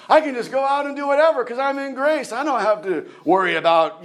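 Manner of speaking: high, gleeful mock defiance; mock-carefree objector. Preacher mimicking someone abusing grace, high mock-carefree voice.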